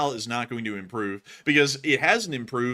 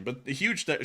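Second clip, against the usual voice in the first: ordinary delivery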